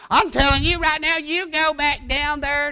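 lightly voiced determined wife; imitating wife. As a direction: play the wife putting her foot down. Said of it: "she don't talk like that".

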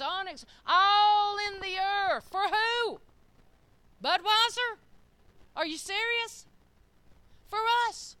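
loud, high, stretched preaching cry; preaching shout. Real, not acted. Preacher shouting to the congregation about riches in the earth, full force. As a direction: build it to a full-voiced shout from the pulpit.

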